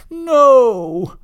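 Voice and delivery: loud, high drawn-out cry of protest; high, indignant shout. A narrator's reading. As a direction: wail the refusal at full voice. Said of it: "cried Blitzen".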